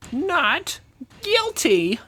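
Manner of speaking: high, dramatic pronouncement; suspenseful verdict. Acted. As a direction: draw out the verdict word by word. Judge's verdict, drawn out for suspense.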